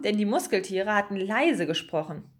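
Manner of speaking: plain reading voice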